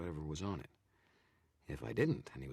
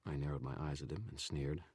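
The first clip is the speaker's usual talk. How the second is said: plain reading voice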